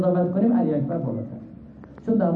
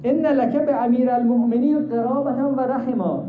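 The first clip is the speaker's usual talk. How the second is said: called out; 7 semitones higher, slower than usual. Acted. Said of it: "داد زد".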